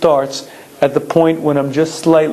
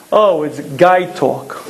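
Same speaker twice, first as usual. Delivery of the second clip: usual speaking voice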